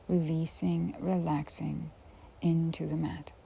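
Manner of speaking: soft, unhurried yoga nidra guidance with long pauses; hushed relaxation-class voice; guided meditation. Acted. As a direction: ease the listener gently down into rest.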